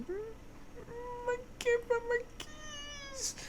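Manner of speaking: high, soft, whiny little voice; sad whimper. Retold or imitated. Reliving losing her keys, a high soft whimper. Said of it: "sadness and disappointment in myself".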